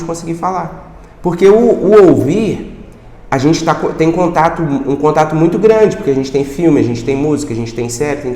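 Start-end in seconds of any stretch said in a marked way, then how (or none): none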